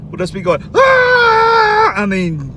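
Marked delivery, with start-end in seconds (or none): 0.7-1.9 very high, loud excited yell; crowd yell. Retold or imitated. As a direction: scream like a whole bus going wild.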